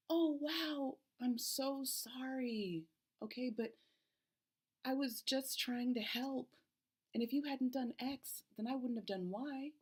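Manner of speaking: high, defensive and self-justifying; defensive apology. Shown as a demonstration. Demoing a bad apology to a partner, high and defensive. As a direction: pile on the excuses after a quick sorry. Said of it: "two versions of what I might say in an argument".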